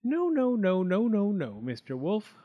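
high, frightened string of refusals; high quiver, settling on the name; quivering pig. A narrator's reading. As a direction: refuse in a panicky little pig voice. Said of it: "quivered the pig".

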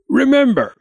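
high, emphatic warning; a father laying down a rule; father's warning. A narrator's reading. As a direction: sound a loud, high note of caution. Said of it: "his father had told him".

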